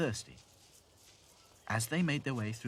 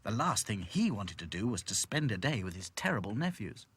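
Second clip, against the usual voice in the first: plain reading voice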